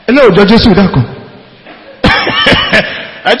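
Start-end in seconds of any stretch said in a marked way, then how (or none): none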